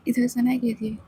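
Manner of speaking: plain reading voice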